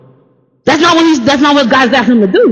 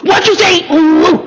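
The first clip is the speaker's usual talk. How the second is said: pitched-up mock growl, picking a fight; a confrontational challenge, voice raised high; 8 semitones higher, slower than usual. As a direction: hit "big brother" like fighting words. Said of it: "and growl at him?".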